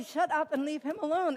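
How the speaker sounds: loud, high retold scolding; exasperated order. Friends telling her to leave the surgeon alone, exasperated.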